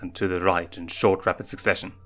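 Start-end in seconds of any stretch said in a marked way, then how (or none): none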